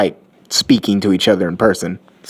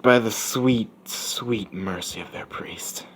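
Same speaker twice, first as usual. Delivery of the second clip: slow whisper, much softer; ominous whisper; 10 dB quieter, slower than usual. Acted. He tells her how they live, in an ominous whisper. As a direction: let it sink into a dark, slow whisper. Ominous answer about the priest.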